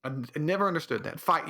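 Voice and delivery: ordinary talk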